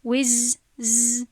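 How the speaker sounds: وز with the ز allowed to buzz on; sustained soft consonant. Shown as a demonstration. Teacher explaining a rikhwah letter, sustained z. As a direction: hold the ز of وز as a flowing sound. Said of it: "وقت دادم. چون که این حرفی رخوه هست".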